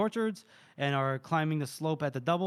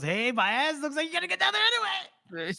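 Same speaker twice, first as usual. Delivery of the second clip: high, climbing sing-out; comically high-pitched teasing; mock taunting call; 11 semitones higher, faster than usual. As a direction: holler a gleeful jab, hammed up. Acted taunt of [name].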